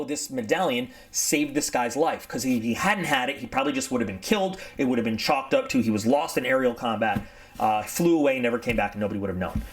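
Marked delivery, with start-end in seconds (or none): none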